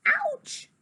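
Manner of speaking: high-pitched yelp of ouch; sudden high ouch; pained ouch. A narrator's reading. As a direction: yelp as if just bitten. Bitten by sister.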